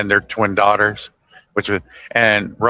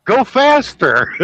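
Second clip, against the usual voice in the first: mock John Candy; 11 semitones higher, slower than usual. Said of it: "What's he saying?".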